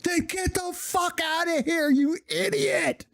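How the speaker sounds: imitating the instructor yelling him out the door; mock drill instructor. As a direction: play the instructor shooing away a fool. Voicing drill instructor's dismissal.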